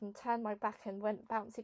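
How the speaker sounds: usual speaking voice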